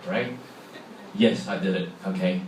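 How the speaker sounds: ordinary talk, nothing marked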